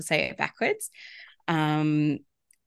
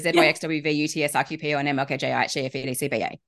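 alphabet backwards at top speed; backwards speech; faster than usual. Shown as a demonstration. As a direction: rattle the letters off as fast as possible. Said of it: "saying the alphabet backwards in under six seconds".

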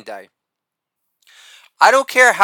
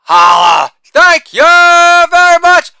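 Holla barked loud, then thank you very much stretched slow and very high; shouted catchphrase; 12 semitones higher, 9 dB louder, much slower than usual. Acted. Comic to audience, hammed-up sign-off, loud high drawn-out shout. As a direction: belt the sign-off like a showman milking applause.

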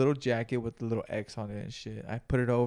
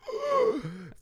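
very high, thin gasp. Retold or imitated. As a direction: gasp like lungs are full. Choking on second hit.

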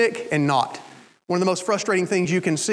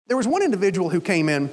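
ordinary delivery

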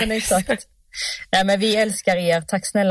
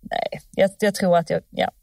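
normal talking voice

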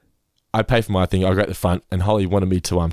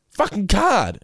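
high-pitched, fed-up mock snap; angry retold; 12 semitones higher, slower than usual. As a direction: imitate an irate customer answering the cashier. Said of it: "like, angry".

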